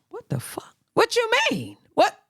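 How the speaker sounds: high, startled "what you mean"; shocked disbelief. Retold or imitated. Reacting to her asking for his social, high and shocked.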